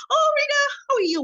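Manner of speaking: bright, high delighted hello; cheery greeting. Retold or imitated. HR manager greeting Rita on arrival, cheery and high. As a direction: greet her like an old friend.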